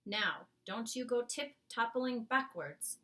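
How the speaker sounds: giant whisper. Acted. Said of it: "the B F G whispered".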